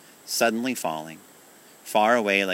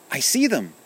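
usual speaking voice

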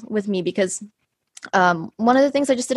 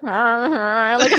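put-on drunken slurring; 5 semitones higher than usual. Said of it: "I was, like, slurring my speech".

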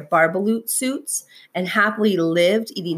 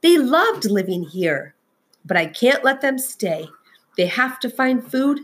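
plain reading voice

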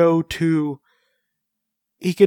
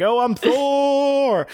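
high, long drawn-out heroic cry; triumphant Thor; 11 semitones higher, much slower than usual. Acted. Newly transformed hero announcing himself, mock-triumphant cry. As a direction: stretch the name out in mock triumph. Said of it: "he's just like".

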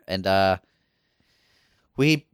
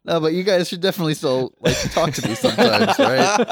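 laughing, pleading, louder at end; laughing plea; 4 semitones higher, 4 dB louder than usual. Real, not acted. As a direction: beg jokingly through laughter. Joking plea to parents.

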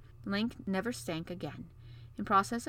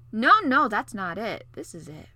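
prince voice; 12 dB louder than usual. A narrator's reading. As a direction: start loud, end tender. Prince corrects princess tenderly.

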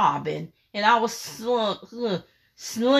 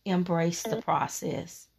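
quiet divine voice; 4 semitones lower, 8 dB quieter than usual. Retold or imitated. God speaking amid her crying.

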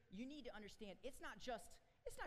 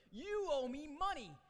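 furious shout demanding the money; furious creditor; 9 semitones higher, 11 dB louder than usual. Acted. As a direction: scream it at the man you're choking. He grabs him by the throat.

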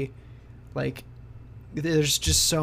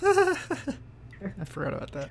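laughing; 10 semitones higher, slower than usual. Real, not acted. Laughs at forgetting the rule.

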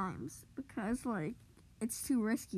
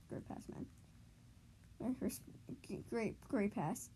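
sarcastic whisper; 11 dB quieter, much slower than usual. Real, not acted. Sarcastic about teammate.

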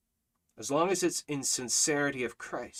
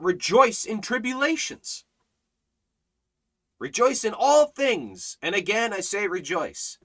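raised, insistent sermon voice; fervent preaching; 6 semitones higher, 3 dB louder than usual. Real, not acted. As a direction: drive each phrase home to the congregation. Preaches rejoicing in all things.

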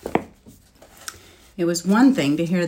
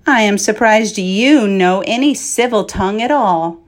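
loom over him and mock; a mocking dragon talking down to a man; scornful dragon; 7 dB louder than usual. Dragon mocks the knight.

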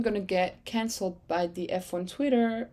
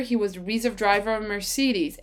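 ordinary delivery

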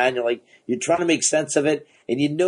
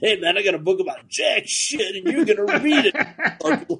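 high-pitched, cackling cheeky boast; gleeful hammed-up sales pitch, stretching out; laughing mock pitch; 7 semitones higher than usual. Acts out writer's cheeky pitch, laughing.

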